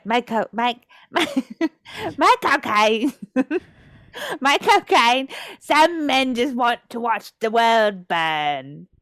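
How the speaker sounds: a mock British accent, slowing on the quote; Michael Caine attempt. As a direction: friend retries the Michael Caine trick, slowly.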